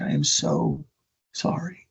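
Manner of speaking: soft, halting, acted regret; low, hushed retold apology; sorrowful apology. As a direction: break the apology with a pause, fading softly. Old man's tearful apology, retold.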